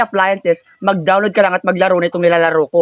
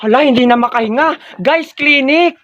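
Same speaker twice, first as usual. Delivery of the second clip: raised, urgent call for help; alarmed; 6 semitones higher than usual. Acted. Classmates alarmed, call for the clinic. (natatarantang tawag ng tulong)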